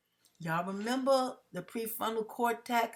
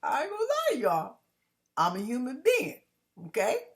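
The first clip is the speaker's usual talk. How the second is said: wave of emotion; 3 semitones higher, 5 dB louder than usual. Real, not acted. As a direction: let emotion surge up through a laugh. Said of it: "see my wave of emotion going up".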